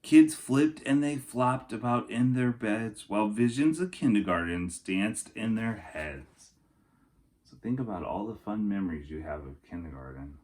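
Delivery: storybook narration for kindergartners; lilting rhyming picture-book voice; reading to children. Acted. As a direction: read it like story time for little kids. Reads picture book aloud.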